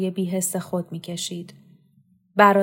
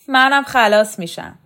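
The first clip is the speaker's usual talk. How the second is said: bitter retort; 4 semitones higher, 8 dB louder than usual. Acted. Mother answers, nervous laugh.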